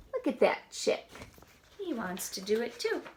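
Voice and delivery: ordinary delivery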